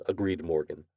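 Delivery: ordinary delivery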